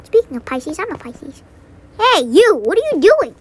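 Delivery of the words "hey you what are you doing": loud, high call of "hey, you"; yelling at someone. Real, not acted. Yelling at a confused villager, loud and high. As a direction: shout across at the villager to get his attention.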